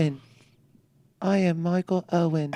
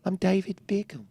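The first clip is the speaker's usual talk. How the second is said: David Beckham impression; much faster than usual. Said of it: "that's a good David Beckham".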